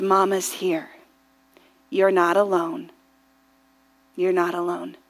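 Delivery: soft, low, tender reassurance; soothing mother. Retold or imitated. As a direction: hush it low and gentle, with pauses between. Mother soothing child, retold.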